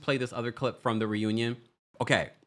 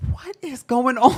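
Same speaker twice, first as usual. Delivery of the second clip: much higher and louder, mimicking his light voice; Simon Guobadia impression; 13 semitones higher, 6 dB louder than usual. Host mimics Simon's voice for viewers, high and loud with a laugh. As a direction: squeak it out high like him, laughing through it. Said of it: "Every time I hear Simon Guobadia's voice".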